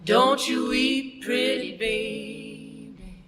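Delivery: plain reading voice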